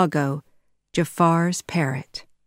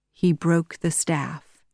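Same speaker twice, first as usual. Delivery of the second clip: plain reading voice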